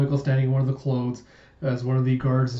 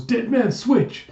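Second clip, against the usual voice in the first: high, loud warning cry, drawn out; raised-pitch yell of warning; urgent shout; 10 semitones higher, 5 dB louder, slower than usual. Acted. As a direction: shout a sudden alarm.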